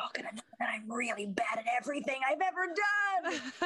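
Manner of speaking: from whisper up to high wail; escalating mock panic. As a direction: start hushed, build to a hammed-up wail. Imposter voice builds to a wail.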